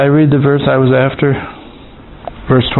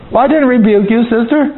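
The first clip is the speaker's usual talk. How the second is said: higher, sing-song voice explaining himself to a sister; hammed-up high voice scolding a sister; mock preachy reply; 9 semitones higher than usual. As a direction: lampoon a pious explanation, pitched up. Mock answer to a church sister.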